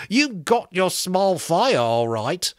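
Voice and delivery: slow, sour sarcasm; sour joke. A narrator's reading. Piggy to the boys, sourly sarcastic. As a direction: sneer the joke out slowly. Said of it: "the sour joke".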